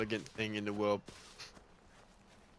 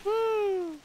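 excited whoop; 7 dB louder than usual. Real, not acted. Cheer while dropping down.

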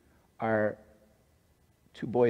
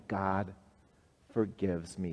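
soft model line; 7 dB quieter than usual. Shown as a demonstration. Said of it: "tell that good news".

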